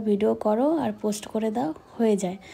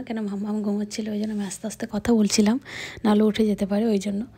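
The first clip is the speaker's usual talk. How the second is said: ordinary talk, nothing marked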